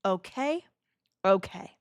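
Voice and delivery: ordinary talk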